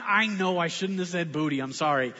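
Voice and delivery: ordinary talk, nothing marked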